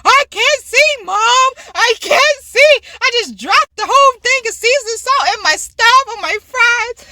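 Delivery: mock narrating wail. Daughter narrating her seasoning mishap to mom, wailing. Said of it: "you were just narrating".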